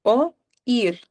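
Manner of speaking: reír, oír, freír drawn out slowly, syllable by syllable; slow syllable demo. Shown as a demonstration. Teacher slows down to separate reír, oír, freír. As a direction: spell out the syllables of freír for the class. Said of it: "tienen dos sílabas".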